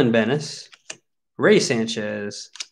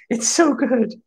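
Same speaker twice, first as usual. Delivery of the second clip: much higher, giggly squeak; giddy amusement; 11 semitones higher, slower than usual. Real, not acted. Card opener delighted by the old gum, giddy high voice. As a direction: squeeze it out high after laughing.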